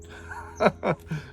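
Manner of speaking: breathy giant laugh; a wicked laugh from the giant; giant's laugh. Acted. Giant laughs after chant.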